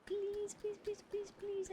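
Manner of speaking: high, pleading please, please; mock whiny pleading; playful begging. Real, not acted. Begging for shout out.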